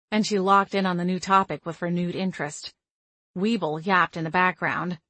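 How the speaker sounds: usual speaking voice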